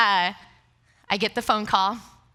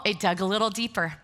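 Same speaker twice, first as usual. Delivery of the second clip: ordinary delivery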